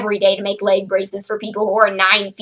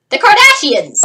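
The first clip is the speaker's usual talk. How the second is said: higher, louder punchline on "the Kardashians"; mock disdain; 9 semitones higher, 5 dB louder than usual. Real, not acted. Vlogger naming the dreaded fate as a punchline, mock disdain. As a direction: hit "the Kardashians" high and loud, with mock horror. Mocking punchline after a pause.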